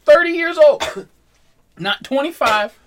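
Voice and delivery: shouted answer, high and loud. Real, not acted. Blurting an answer to friends.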